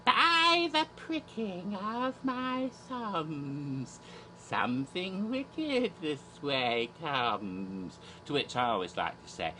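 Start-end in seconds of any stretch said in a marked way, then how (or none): 0.0-8.0 very slow, drawn-out eerie recitation; theatrical Shakespeare line. Acted. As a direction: intone it like a stage witch, starting high and sinking. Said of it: "but the famous line".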